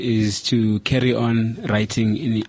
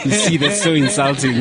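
laughing; 4 semitones higher, 4 dB louder than usual. Real, not acted. Laughing at the joke.

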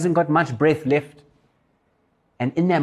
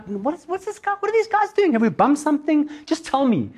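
high-pitched flustered questioning; baffled exasperation; 12 semitones higher than usual. Retold or imitated. Driver wondering what these guys are doing, high and exasperated. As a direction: act out a puzzled driver demanding answers.

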